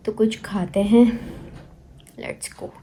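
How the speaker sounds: soft unvoiced whisper, rising at the end. Real, not acted. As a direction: whisper it like a little secret. Playful hungry aside to viewers. (फुसफुसाकर, राज़ की तरह)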